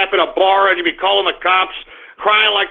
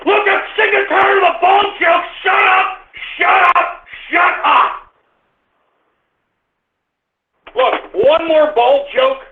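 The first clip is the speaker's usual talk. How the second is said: much higher, shouted warnings; furious shouting; 7 semitones higher than usual. Real, not acted. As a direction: lose it, threatening to end the stream. Final blowup over bald jokes.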